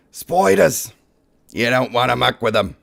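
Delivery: exaggerated Australian accent on spiders; a Brit's jokey Australian accent about spiders; mock Australian accent. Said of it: "trying to sound Australian".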